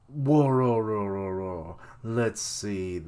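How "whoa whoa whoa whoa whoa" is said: stunned whoa trailing off; loud whoa fading. Real, not acted. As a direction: start loud, let it die away. Reacting to the hour.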